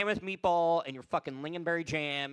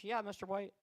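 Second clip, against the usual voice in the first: ordinary talk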